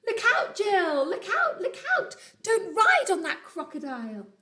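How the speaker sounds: a little bird singing out a warning; high chirpy alarm call to Jill; sing-song bird warning. Acted.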